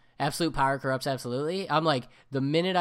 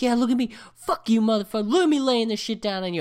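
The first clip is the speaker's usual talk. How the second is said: much higher, much louder triumphant yell; gloating yell; 10 semitones higher, 8 dB louder than usual. Retold or imitated. Gamer yelling into the mic at opponents, gloating shout. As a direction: crow over the beaten players at full volume. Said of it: "I'm, like, yelling into the mic".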